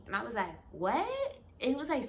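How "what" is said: rising, puzzled what; bewildered rising question; confused what. Retold or imitated. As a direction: ask it like you missed every word. Not hearing the mumbling man.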